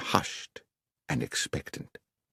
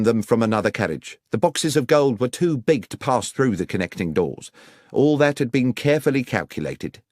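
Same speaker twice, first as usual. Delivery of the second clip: lightly voiced earnest police inspector; a hint of a dutiful inspector, quick in places; inspector reporting; 6 semitones higher, 4 dB louder, faster than usual. Hopkins explains the locked doors to Holmes, earnest.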